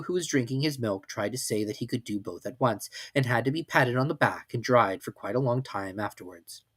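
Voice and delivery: ordinary delivery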